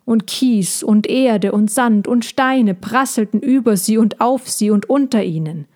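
ordinary delivery

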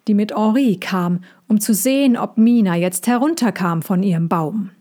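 plain reading voice

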